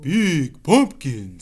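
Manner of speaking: slow, loud vampire drawl. Acted. Vampire eyeing the pumpkin, greedy. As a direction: savour it like a vampire. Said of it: "said the vampire".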